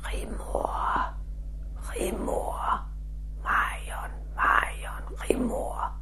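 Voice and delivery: unvoiced ghostly whisper calling a name; soft eerie spirit whisper. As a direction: breathe the name like a voice from the cabinet. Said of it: "Vi hører pludselig en stemme".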